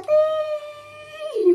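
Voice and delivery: high, loud ding like a doorbell; imitated doorbell. Mimicking the doorbell that startled her, loud high ding. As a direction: ring it out like a loud doorbell chime. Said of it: "Yung panglakas-lakas".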